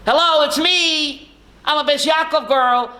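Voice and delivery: acted reveal, pitched well above his own voice; unmasked Esther. Esther takes off her mask on Purim, high acted reveal.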